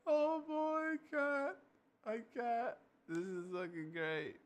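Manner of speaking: can barely speak for laughing; giggling fit of delight. Real, not acted. Can't stop laughing.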